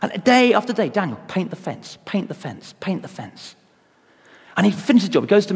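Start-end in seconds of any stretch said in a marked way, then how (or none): 0.9-3.6 fading repeated command. Retold or imitated. Day after day repetition, fading.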